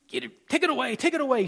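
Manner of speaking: high-pitched mock disgust, repeated impatiently. Pastor imitates the king waving off the pen tray, disgusted. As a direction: imitate a fussy royal dismissing something. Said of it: "he makes this disgusting little look".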